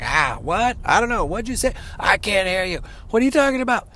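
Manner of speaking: high, squeaky, put-upon voice of someone calling back across the house; hammed-up high whiny comeback; mock squeaky shouted-over reply. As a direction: play the exasperated family member who can't hear. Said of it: "and you're like".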